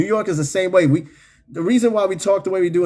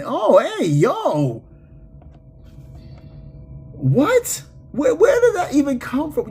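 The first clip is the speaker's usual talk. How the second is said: high-pitched incredulous outburst; shocked disbelief; 11 semitones higher, slower than usual. Real, not acted. Streamer thrown by a chat message, high shocked disbelief. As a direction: react baffled, voice jumping way up.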